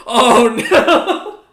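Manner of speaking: loud, high laughing "oh no"; laughing dismay. Real, not acted. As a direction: crack up in mock despair. GM reacts to a dice roll.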